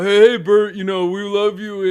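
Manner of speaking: high, loud mock fan greeting; adoring fans calling out. Everybody in the class talking to the famous actor, adoring. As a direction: call out gushingly to a star. Audience members calling out to Burt.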